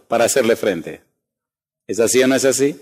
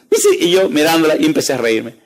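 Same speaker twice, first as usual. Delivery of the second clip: ordinary delivery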